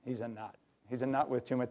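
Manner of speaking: hushed, fond aside about Dan; mock him fondly under your breath; soft, teasing staffer voice; affectionate teasing. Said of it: "then they get to know".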